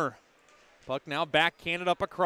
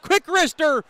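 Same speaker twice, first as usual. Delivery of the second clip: sudden jump in pitch on the shot; excited call; 9 semitones higher, 6 dB louder than usual. Real, not acted. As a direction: punch it out as the shot fires. Shot on goal in play-by-play.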